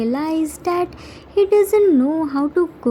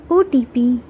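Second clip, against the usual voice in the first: usual speaking voice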